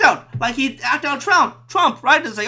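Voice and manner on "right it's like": usual speaking voice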